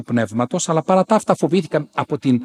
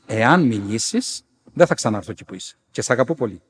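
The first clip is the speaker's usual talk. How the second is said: gentle warning; 3 semitones lower than usual. Retold or imitated. Saint warning the nun with love, gentle. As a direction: warn calmly and tenderly. Apparition repeats warning lovingly.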